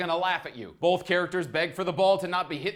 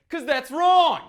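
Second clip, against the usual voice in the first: sharp scold; 9 semitones higher, 7 dB louder, slower than usual. Real, not acted. Scolding commenters.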